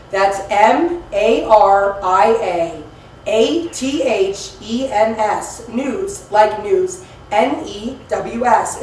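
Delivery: slow, spelled out letter by letter; dictating. Real, not acted. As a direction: spell it out slowly. Spelling email for the caller.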